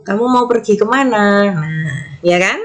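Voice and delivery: ordinary talk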